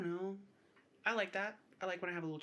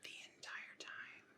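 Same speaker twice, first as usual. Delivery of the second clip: slow unvoiced whisper; ASMR whisper; 15 dB quieter, slower than usual. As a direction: whisper it into the mic. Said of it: "we will be whispering into the mic".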